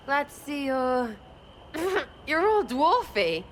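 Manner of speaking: slow teasing words through a laugh; laughing tease. Real, not acted. Teasing the dwarf, slow words through a laugh. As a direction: chuckle as you mock his height.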